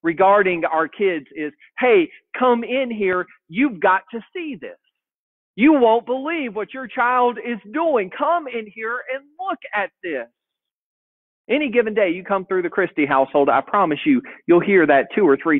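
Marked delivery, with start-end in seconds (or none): 1.8-10.3 calling out. Retold or imitated. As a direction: call out, amazed and eager. Parent calling spouse to see child.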